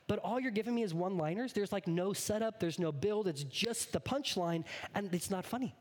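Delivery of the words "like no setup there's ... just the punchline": normal talking voice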